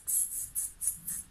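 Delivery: repeated soft voiceless tss; short soft bursts of tongue-tip TS; TS sound demo. Shown as a demonstration. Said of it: "how to pronounce ts".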